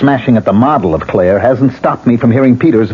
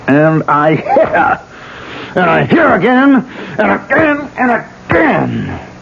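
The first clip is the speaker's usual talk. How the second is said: frenzied rising blows; 10 semitones higher, slower than usual. Acted. Beating the dummy of Claire, building to a frenzied peak. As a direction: pitch climbing higher with each blow, fading off at the end.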